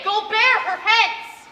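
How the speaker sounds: a loud, slow, furious order; shouted command. Acted. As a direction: bark the order so it cannot be refused. Repeated order, louder.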